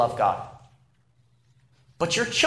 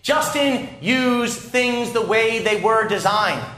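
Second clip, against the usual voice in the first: raised, measured mock reprimand; imitated stern father; 5 semitones higher, slower than usual. Father's oft-heard phrase to his son, sternly imitated. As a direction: repeat dad's stock scolding, pitched up and emphatic. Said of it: "he would sternly say".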